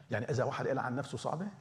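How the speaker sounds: ordinary delivery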